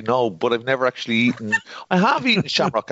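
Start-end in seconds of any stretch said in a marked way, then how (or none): 1.9-2.9 sudden realisation. Real, not acted.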